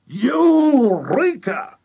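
very high, long, loud "Eureka"; triumphant cry. A narrator's reading. Mister Cranky hearing the answer, triumphant high cry. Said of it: "cried Mister Cranky".

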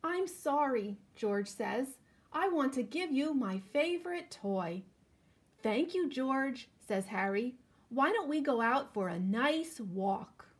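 put-on slow, lively storybook reading for kids; reading to children. A narrator's reading. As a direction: read it out like story time for little ones. Reading picture book to children.

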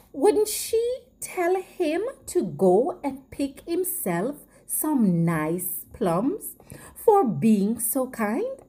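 plain reading voice

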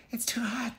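a mild mock cry at hot porridge; little girl crying out. Goldie tasting hot porridge, high girlish mock cry. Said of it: "she cried out".

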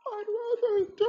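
high, loud overwrought parody; imitating overacting. Reviewer mocks the actor's overacted line, high and loud. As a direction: ham up the line like a bad actor. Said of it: "like he said".

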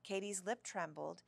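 ordinary delivery, nothing marked

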